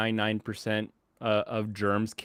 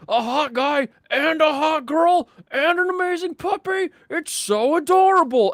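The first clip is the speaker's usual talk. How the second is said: mock swooning guy; 6 dB louder than usual. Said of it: "And this guy's like".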